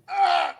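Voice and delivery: high mock yelp of shock. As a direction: yelp like your heart just stopped. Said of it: "I was like".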